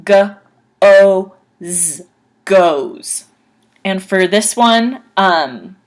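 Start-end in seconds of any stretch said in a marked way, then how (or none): 0.0-2.0 G-O-Z said letter by letter; spelled out by sound. Shown as a demonstration. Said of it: "it's pronounced". 2.4-3.2 goes ending in a clear z; teacher's goes; careful pronunciation. Shown as a demonstration. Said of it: "it's pronounced".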